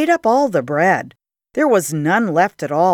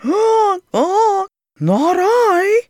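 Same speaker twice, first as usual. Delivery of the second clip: high squawking goose voice; 13 semitones higher, much slower than usual. A narrator's reading. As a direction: honk it high and silly. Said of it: "said the goose".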